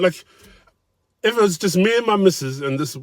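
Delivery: ordinary talk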